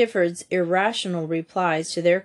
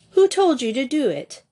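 higher, insistent question; demanding; 6 semitones higher, 4 dB louder than usual. A narrator's reading. Louise questioning the captive, demanding. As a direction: demand an answer from him. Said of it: "Louise demanded".

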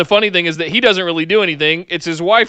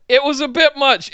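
laughing; 7 semitones higher than usual. Real, not acted. Repeats, laughing.

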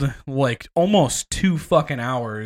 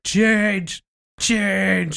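mock belligerent shout; 6 semitones higher than usual. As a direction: yell it like an angry dad. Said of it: "shouting and getting belligerent".

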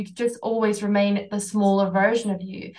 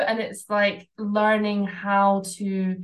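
ordinary talk

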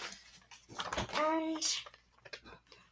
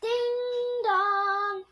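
high, loud sing-song call; shouting; 9 semitones higher, 7 dB louder, much slower than usual. A narrator's reading. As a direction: call out loudly for someone in another room. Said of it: "shouted Eugenia".

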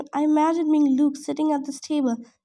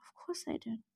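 whisper; 5 semitones lower, 18 dB quieter than usual. Acted. Confused mother trailing off.

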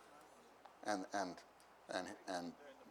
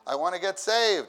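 louder retry; 7 semitones higher, 7 dB louder than usual. Retold or imitated. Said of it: "he didn't say it like that at first".

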